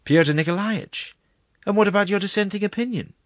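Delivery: high, loud alarmed protest; dismayed question. A narrator's reading. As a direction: call after him, voice shooting up. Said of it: "asked the secretary in dismay".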